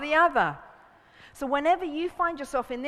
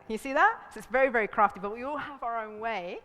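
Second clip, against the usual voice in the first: ordinary talk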